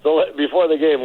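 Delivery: ordinary talk, nothing marked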